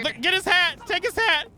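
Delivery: high, loud excited shout; yelling gleefully to grab the hat. Real, not acted. Streamer yelling at his friend to snatch a player's hat, excited high shout.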